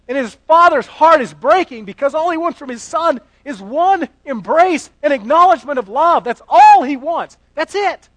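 high, loud, pleading sermon climax; impassioned preaching. Acted. Preacher to congregation, the father's heartbreak, impassioned and loud.

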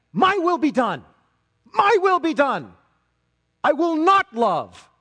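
loud, high, emphatic mock defiance; defiant declaration. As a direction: proclaim it like a stubborn refusal. Voicing a defiant refusal to love.

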